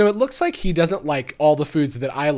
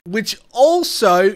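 slow, higher, louder mock Aussie echo; Australian accent; 7 semitones higher, 9 dB louder, slower than usual. YouTuber mimicking the chocolate guy, slow mock Australian accent. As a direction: drag out "also" in a put-on Australian accent. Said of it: "working on my Australian accent".

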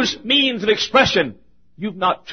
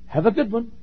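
mocking cliche; 5 semitones higher, 4 dB quieter than usual. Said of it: "I hate that with a passion".

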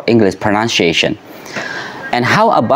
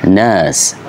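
"nurse" as /nəs/, no r, long central vowel; British accent; 5 semitones lower than usual. Shown as a demonstration. Teacher showing learners the British nurse, r dropped. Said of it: "but in British accent, you can't say".

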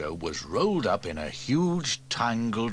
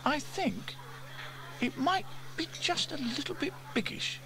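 high-pitched tactful remark; James, tentative; 4 dB quieter than usual. A narrator's reading. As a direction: offer it hesitantly as a small boy. Child James comments carefully.

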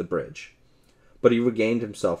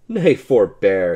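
laughing call; 9 dB louder, slower than usual. A narrator's reading. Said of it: "laughing until his sore sides ached".

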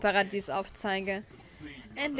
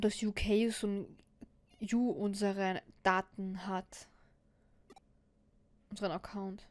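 whisper; 4 dB quieter, slower than usual. Acted. Inner monologue voiced.

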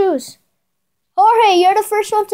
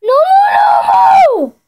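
shouted no; 9 semitones higher, 5 dB louder, slower than usual. Real, not acted. Losing in game.

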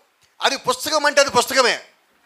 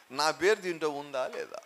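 hushed worried question; 8 semitones lower, 14 dB quieter than usual. Acted. John's anxious inner question.

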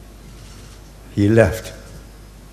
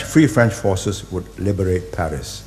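ordinary delivery

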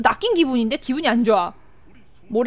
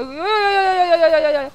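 one long run of higher-pitched cries; panicked yelling; 4 semitones higher, slower than usual. Real, not acted. Player caught in an attack, panicked wailing. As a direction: keep repeating it in a rising panic.

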